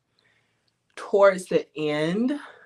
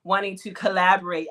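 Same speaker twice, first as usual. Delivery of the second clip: usual speaking voice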